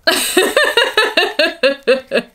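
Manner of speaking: loud high laugh; bright laugh. Real, not acted. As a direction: laugh at the joke. Laughing at Louboutins.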